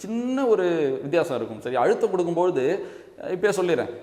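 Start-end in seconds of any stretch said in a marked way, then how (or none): none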